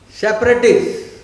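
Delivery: "separatists" burst out loud and high; loud emphasis. Real, not acted. Teacher naming the tricksters to the class, loud emphasis. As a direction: punch out "separatists" as the verdict. Drives the key word home.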